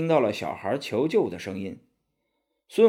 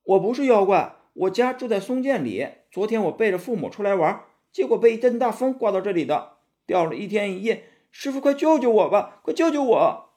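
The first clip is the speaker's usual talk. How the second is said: put-on a small boy crying out to be saved; pleading child; 9 semitones higher than usual. Demon disguised as a child begging the monk, high pleading child voice. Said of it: "那个小孩辩解道".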